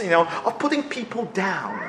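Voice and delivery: normal talking voice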